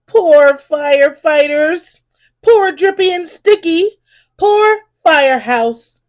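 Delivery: ordinary delivery, nothing marked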